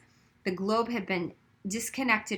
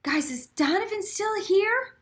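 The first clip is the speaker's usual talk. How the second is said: higher, slow anxious question; anxious plea; 11 semitones higher, slower than usual. A narrator's reading. Girl asking the boys about Donovan, anxious. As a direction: ask hopefully.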